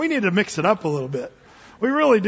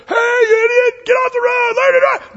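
high, loud shout at a driver; furious hammed-up screaming; road-rage yell; 14 semitones higher, 7 dB louder than usual. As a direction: blow up at the car ahead. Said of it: "instead of road rage".

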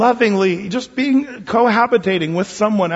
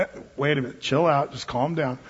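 calming; 4 semitones lower, 6 dB quieter than usual. Pretend. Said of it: "calming the angry listener".

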